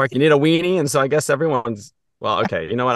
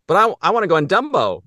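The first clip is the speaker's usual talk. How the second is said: put-on kid voice; 8 semitones higher, 4 dB louder than usual. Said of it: "the kid's just like".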